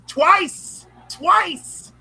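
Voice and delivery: twice punched high and loud both times, twice; emphatic repeat. Real, not acted. Cohost insists Cliff died twice, loud emphatic repeat. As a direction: hammer home "twice", pitch up each time.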